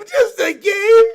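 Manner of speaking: outraged shout. Acted. Bear repeats, higher.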